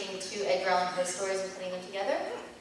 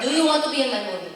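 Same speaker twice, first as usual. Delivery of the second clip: quick, louder mock Italian accent; Italian accent imitation; 5 semitones higher, 7 dB louder, faster than usual. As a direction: ham up a director's Italian accent. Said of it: "very thick Italian accent".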